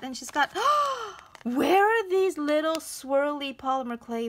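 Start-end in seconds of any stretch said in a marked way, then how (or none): 0.5-1.4 delighted gasp. Real, not acted. Surprised by clay bits.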